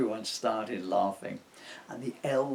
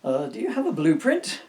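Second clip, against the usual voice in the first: elf foreman voice; 4 semitones higher, 10 dB louder than usual. Acted.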